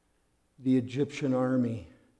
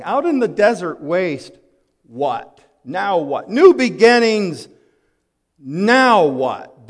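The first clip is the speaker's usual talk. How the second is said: loud, exasperated mock complaint; grumbling Israelites; 4 semitones higher, 10 dB louder than usual. Preacher voicing the stranded Israelites, loud mock grumble. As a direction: throw up your hands: now what?.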